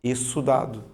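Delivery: exudado said e-su-dado, one s sound; teacher giving the right exudado; correct exudado. Shown as a demonstration. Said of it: "aí eu te digo".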